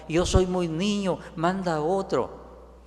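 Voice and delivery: a mock tearful plea. Jeremiah begs God to send another, mildly tearful. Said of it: "se puso a llorar".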